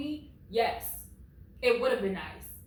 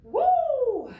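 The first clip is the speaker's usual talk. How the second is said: high, loud woo of disbelief; high shocked woo; shocked whoop; 9 dB louder than usual. Real, not acted. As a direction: let out a startled whoop. Reacting to the insult.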